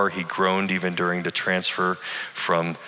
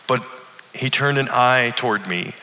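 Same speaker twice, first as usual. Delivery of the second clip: plain reading voice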